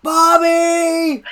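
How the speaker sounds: long, high, booming shout; exasperated yell. Retold or imitated. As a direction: holler the name, stretching it out. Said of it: "I hear him yell".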